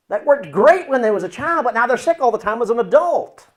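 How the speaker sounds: loud, high exclamation; exclaiming. Acted. Preacher to audience on a backfired childhood fix, exclaiming. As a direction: raise the voice and pitch sharply. Voicing frustration at childhood coping.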